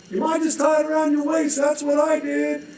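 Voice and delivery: high, shouted suggestion; smug dangling man. Acted. Dangling man advising the holder, high and smug. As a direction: offer it up smugly.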